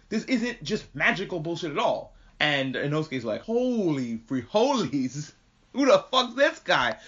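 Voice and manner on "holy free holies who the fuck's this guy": drawn-out awe rising high; awestruck. Retold or imitated. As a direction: mimic amazed disbelief, slow then high. Acting out Inosuke's awed reaction.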